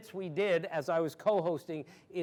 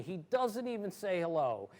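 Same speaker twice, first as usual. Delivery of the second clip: usual speaking voice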